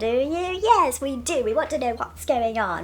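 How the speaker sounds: hammed-up sketch voices with a loud burst; mock-dramatic announcement, swooping into a loud reveal; Monty Python. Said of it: "I'm mocking Monty Python".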